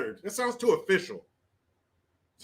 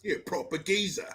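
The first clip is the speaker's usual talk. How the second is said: softer British aside; mock British accent; 7 dB quieter than usual. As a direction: toss it off softly in British. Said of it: "I wanna say in this accent".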